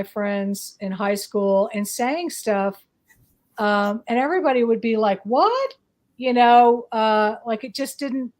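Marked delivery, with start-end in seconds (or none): 5.2-5.7 sharp rising puzzled what; mock confused friend; baffled what. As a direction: toss it up like friends who don't get it. Friends' baffled reaction retold.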